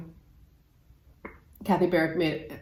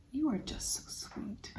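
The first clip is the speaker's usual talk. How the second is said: hushed, adoring whisper; 6 semitones higher, 15 dB quieter than usual. Acted. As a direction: whisper it sweetly, as to a tiny treasure. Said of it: "whisper to me".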